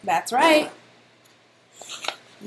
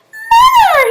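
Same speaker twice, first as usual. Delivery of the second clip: high, loud startled scream; child spider screaming for his mother; frightened scream; 12 dB louder than usual. A narrator's reading. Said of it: "he screamed".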